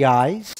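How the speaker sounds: "Eyes" said as a clear single syllable; model word. Shown as a demonstration. Teacher models the word for students, slow and clear. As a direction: hold up "Eyes" for repeating. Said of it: "We say".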